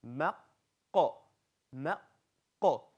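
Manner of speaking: ma then ko with the q from the back of the tongue; ma ko drill. Shown as a demonstration. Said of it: "Now say".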